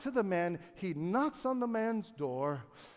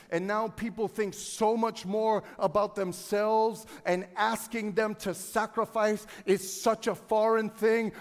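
louder, driving sermon; preaching with force; 5 semitones higher, 9 dB louder than usual. Acted. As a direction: hammer each line home. Fervent sermon build.

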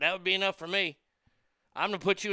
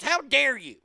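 imitating an indignant scolding woman, higher pitched; mock outrage, pitched up; outraged Karen; 6 semitones higher, 4 dB louder than usual. Mimics Karen confronting truck driver.

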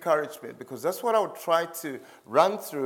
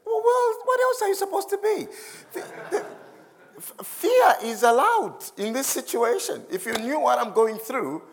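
high, mock-indignant voice with a laugh; squeaky, exasperated mock protest, much higher; scared objector; 12 semitones higher, 4 dB louder than usual. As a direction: play the panicked listener pushing back. Mocking a frightened listener's retort.

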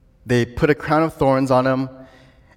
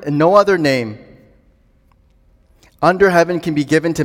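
raised preacher's voice, key words punched; emphatic preaching; 3 semitones higher, 4 dB louder than usual. Real, not acted. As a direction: proclaim it to a congregation with rising conviction.

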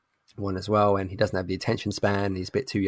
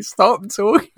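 very high, loud mock yell; comic, pitched-up desperate yelling; exasperated shout; 11 semitones higher, 7 dB louder, slower than usual. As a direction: shout over someone to silence them. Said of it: "I just started shouting".

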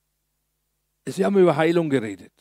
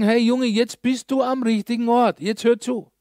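higher, lively encouraging call; eager call; 6 semitones higher than usual. Retold or imitated. Friends telling the man he's in the right place, eager. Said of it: "Und die sagen".

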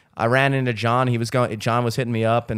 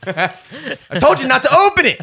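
high-pitched, louder, laughing through it; laughing high mock scold; 7 dB louder than usual. Scolding Dom for opening the door, laughing high and loud. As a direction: scold him while cracking up.

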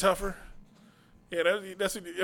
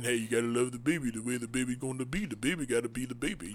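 Rocky impression; 7 semitones lower, 8 dB quieter than usual. Rocky quoted in movie recap.

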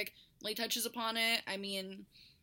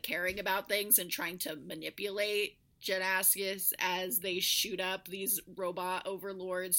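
ordinary talk

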